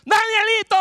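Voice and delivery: very high, loud anxious call; mother searching for her hidden child, worried. Acted. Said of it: "la mamá más preocupada".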